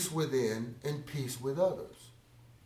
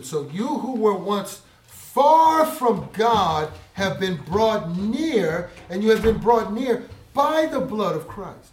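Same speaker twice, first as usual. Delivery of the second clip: loud, slow, ringing scripture read; booming pulpit delivery; preaching at full force; 6 semitones higher, 9 dB louder, slower than usual. Acted. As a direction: proclaim each word slowly to the crowd. Preaching climax.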